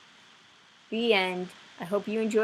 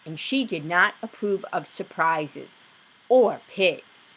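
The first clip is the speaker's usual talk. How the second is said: plain reading voice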